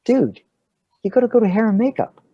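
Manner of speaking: high, quick mock exasperation; exasperated urging. Assistant director sends actor to hair and makeup, exasperated. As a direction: imitate a crew member hurrying someone along.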